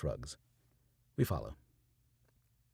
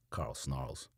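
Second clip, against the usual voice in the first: usual speaking voice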